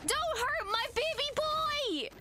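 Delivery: high, drawn-out scream; protective wail. Real, not acted. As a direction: wail it high and slow, pleading. Protective plea during the fight.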